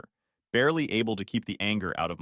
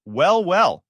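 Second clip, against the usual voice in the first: mocking, higher and louder; sarcastic; 7 semitones higher, 8 dB louder than usual. A narrator's reading. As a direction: drip with sarcasm. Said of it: "she said with a sarcastic laugh".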